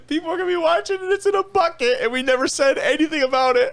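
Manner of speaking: high voice cracking with laughter; laughing hard. Real, not acted. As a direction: talk through tears of laughter.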